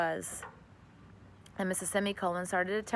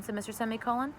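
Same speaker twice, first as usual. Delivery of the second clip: ordinary delivery, nothing marked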